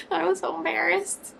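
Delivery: high, laughing through embarrassment; embarrassed laugh. Real, not acted. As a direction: giggle it out, mortified.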